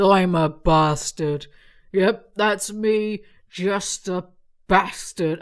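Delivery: smug mock pride; hammy put-on bastard voice. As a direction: ham up the self-proclaimed bastard. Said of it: "I can't do an accent".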